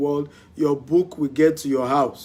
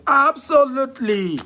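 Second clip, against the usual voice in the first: emphatic yes; 10 semitones higher, 5 dB louder than usual. Real, not acted. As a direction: answer with emphatic, raised-pitch conviction. Emphatic answer to investment question.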